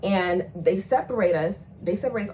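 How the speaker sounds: normal talking voice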